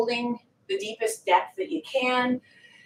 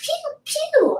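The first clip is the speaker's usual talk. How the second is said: playful pew pew. Pretend. Playful shooting noise.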